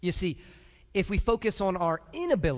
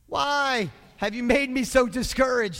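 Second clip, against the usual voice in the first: loud, high cry of complaint; drawn-out, raised-voice protest; blaming God; 5 semitones higher, 6 dB louder, slower than usual. Acted. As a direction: wail your accusation up to heaven. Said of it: "just blame this on God".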